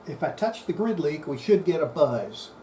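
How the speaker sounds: ordinary talk, nothing marked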